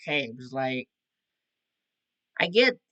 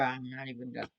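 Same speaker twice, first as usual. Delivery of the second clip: normal talking voice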